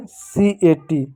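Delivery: letters spelled slowly, one by one; slow teacher spelling. Shown as a demonstration. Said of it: "अब यहाँ पे इसको क्या पढ़ोगे?".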